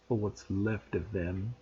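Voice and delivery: quiet, slow spiteful parting line; nasty threat. A narrator's reading. Caretaker leaving the pupils, nasty and quiet. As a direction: let the menace drip out. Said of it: "he added nastily".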